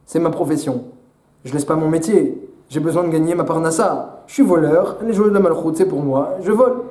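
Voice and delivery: low, unbothered thief voice; brazen thief. Acted. A thief tells the guard, in a low casual voice. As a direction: shrug it off like a pro thief. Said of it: "il dit au gardien".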